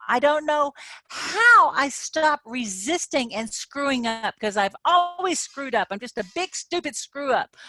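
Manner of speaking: high, loud, self-hating rant; acted self-loathing. Coach acting out self-criticism for viewers, high and loud. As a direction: vent at yourself in disgust. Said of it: "I'm acting".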